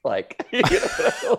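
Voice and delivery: high laughing squeal; laughing through the words. Real, not acted. Laughing heavily.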